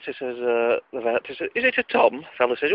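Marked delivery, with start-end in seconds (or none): 1.5-2.3 high-pitched vet in a joke; a chirpy vet asking. Acted.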